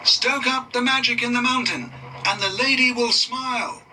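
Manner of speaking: mild called-out chant; called after them. A narrator's reading. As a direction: call the charm after departing engines. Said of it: "Mister Conductor called after them".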